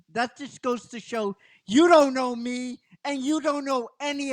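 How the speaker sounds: high, tearful defiance; Kimberly defying the villains, high and tearful; imitating Kimberly's defiant speech. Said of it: "And she just cries".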